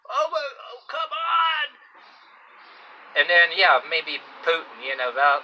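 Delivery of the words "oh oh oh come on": high screaming wail, half laughing; mock Hitler screaming. Hitler shrieking in torment at his cart. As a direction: shriek it like a tortured dictator. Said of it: "screaming in German".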